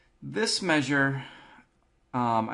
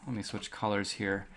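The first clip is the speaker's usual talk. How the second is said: usual speaking voice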